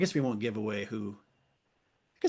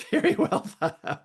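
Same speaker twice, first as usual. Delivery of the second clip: laughing sarcasm; 12 semitones higher, 4 dB louder than usual. Real, not acted.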